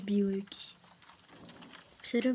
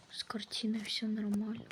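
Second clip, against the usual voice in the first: eerie whisper; 3 semitones lower, 6 dB quieter than usual. Pretend. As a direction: whisper it as the painting. Answers for the painting. (моторошний шепіт)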